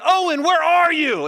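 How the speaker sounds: loud, high panicked yell; father yelling into the empty showroom. Retold or imitated. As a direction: scream for the lost child. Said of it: "I'm yelling".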